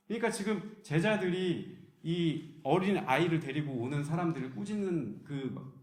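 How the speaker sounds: ordinary delivery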